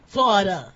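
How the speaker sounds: mock the accented Florida; exaggerated "Florida" with New York aw; unfamiliar pronunciation. Shown as a demonstration. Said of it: "'Cause I don't say Florida".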